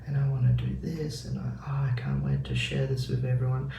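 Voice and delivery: a lightly voiced smug positive mind; sweet positive voice. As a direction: murmur like the mind flattering itself. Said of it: "'cause it's so positive".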